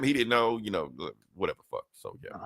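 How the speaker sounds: usual speaking voice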